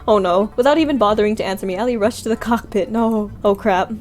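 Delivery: usual speaking voice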